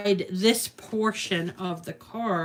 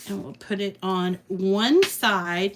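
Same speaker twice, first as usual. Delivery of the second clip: ordinary delivery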